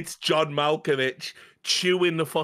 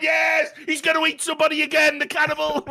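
high, loud excited yelling; shouting in delight at the screen; 5 dB louder than usual. Retold or imitated. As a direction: cheer it like a crowd at the movies. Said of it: "we all went".

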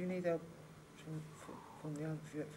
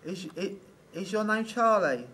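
calling out loud; 8 dB louder, slower than usual. Real, not acted. Calling loudly to the spirit.

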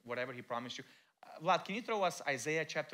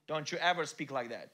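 normal talking voice

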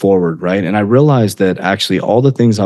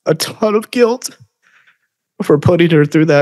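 choked up; 9 semitones higher than usual. Real, not acted. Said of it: "hard for me not to get emotional".

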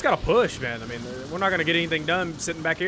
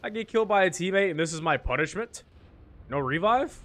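usual speaking voice

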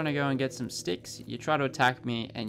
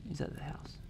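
whisper; 2 semitones higher, 9 dB quieter than usual. Real, not acted. Whispered repeat.

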